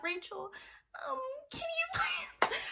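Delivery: tiny, very high mock-pleading voice; timid pleading. Younger sister begging Rachel for permission, timid and squeaky. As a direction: squeak out a hesitant, soft request.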